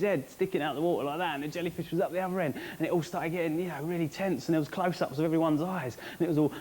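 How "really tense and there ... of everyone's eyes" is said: ordinary talk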